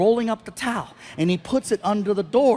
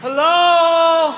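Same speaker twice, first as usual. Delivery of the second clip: high, loud call at the window; drunk customer; 7 semitones higher, 5 dB louder than usual. Retold or imitated.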